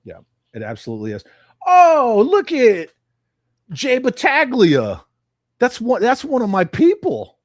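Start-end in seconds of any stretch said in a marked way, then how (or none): 1.7-7.4 delighted high call-out of a name; pleasantly surprised, voice jumps up and louder; spotting a friend in chat, excited. Real, not acted.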